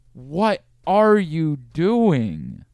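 slow, disbelieving what are you doing; exasperated. Real, not acted. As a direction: drag it out in disbelief. Exasperated rhetorical question.

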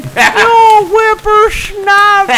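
put-on high, slow, loud old-man yell while laughing; cranky old man yelling. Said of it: "shaking his cane, going".

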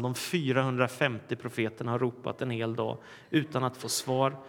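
ordinary delivery

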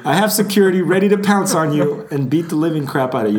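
mock tirade, high and loud; hammed-up threat to an intruding fan; threatening celebrity. Celebrity warning a fan about security, comically furious.

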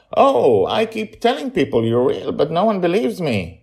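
put-on high, loud child asking back; a small boy confused by the question; puzzled little boy. Charlie repeats, child voice.

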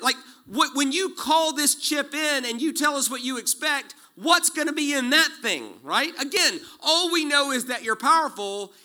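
high, loud, urgent preaching; preaching at full force. Real, not acted. Preacher pressing the congregation, fervent. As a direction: drive the questions home with fervor.

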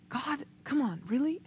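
soft, high-pitched protest to God; exasperated complaint. Retold or imitated. Preacher retells protesting to God at bedtime, exasperated. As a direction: plead under your voice, rising into disbelief.

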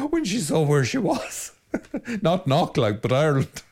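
laughing. Real, not acted. Priest laughing at his own joke about Knock. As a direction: chuckle out the joke about Ireland.

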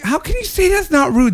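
can't believe what he just said; incredulous. Retold or imitated. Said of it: "And I was like".

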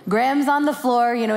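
ordinary talk

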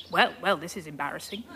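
quick, soft, embarrassed; embarrassed mutter. Acted. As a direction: mutter it sheepishly. Witch deflated by her mistake.

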